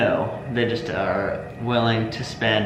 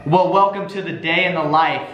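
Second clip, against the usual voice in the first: higher-pitched, measured poem delivery; slam poetry recital; 6 semitones higher than usual. Acted. Contestant performing slam poetry for a vote, theatrical recital. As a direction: perform it line by line, with dramatic pauses.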